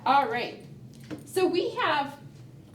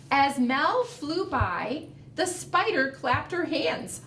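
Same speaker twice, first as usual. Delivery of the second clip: put-on reading to children; slower than usual. A narrator's reading. Reads picture book aloud.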